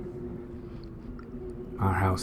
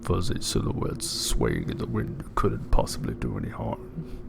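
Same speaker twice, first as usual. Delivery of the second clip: much lower, put-on deep narrator voice; 12 semitones lower, 3 dB quieter than usual. Streamer reading the story in a put-on deep voice. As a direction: drop into a big deep reading voice. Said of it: "Get my deep voice on".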